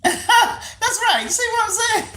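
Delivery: high, loud laughing words; squealing with laughter. Real, not acted. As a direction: laugh your way through it.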